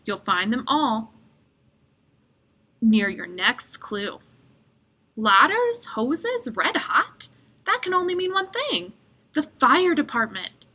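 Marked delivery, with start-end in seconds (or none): 5.2-10.6 much higher, eager puzzling out; excited realisation. Acted. Boy solving the clue, excited. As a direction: piece it together with growing glee.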